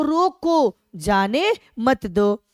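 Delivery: stretched रोको, then जाने मत दो after a break; pause after रोको. Shown as a demonstration. Teacher explaining the first meaning to students, pause after रोको. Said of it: "मैंने उसको".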